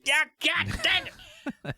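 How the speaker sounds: high, twangy cowboy outburst; irritated card player; mock cowboy exclamation. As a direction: exclaim like a sore loser. Poker players retold.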